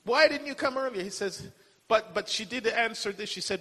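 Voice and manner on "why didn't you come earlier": higher, imitating a complaining believer; mock whining at God, voice raised high. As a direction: act out a grumbling prayer, pitched up. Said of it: "that's how we talk".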